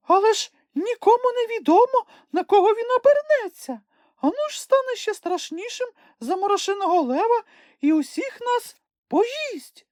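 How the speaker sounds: very high, timid fearful voice; petrified squeak. A narrator's reading. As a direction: Sniff frightened of the beast. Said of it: "стетерів Чмих". (дуже високий переляканий писк)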